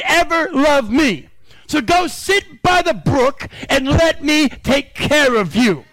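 fervent preaching with slow, emphatic lines. Acted. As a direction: promise it to the church, slowly and firmly. Preaching God's promise.

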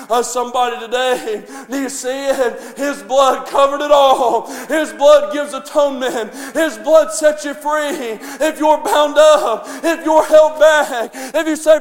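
ordinary delivery

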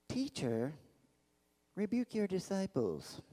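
soft, put-on Pharisee voice; sneering Pharisee. Pharisee in the crowd rebuking Jesus, sneering. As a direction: sneer it quietly, like a haughty objector. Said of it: "I just think that's how they talked".

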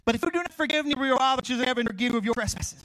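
loud, high preacher's reading of scripture, slowing at the end. Acted. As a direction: proclaim the verse to the congregation.

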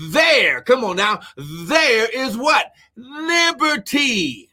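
full-voiced preaching, key words stretched out. Real, not acted. Preacher expounding a Bible verse to the congregation, preaching. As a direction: preach the verse with drive, holding big words.

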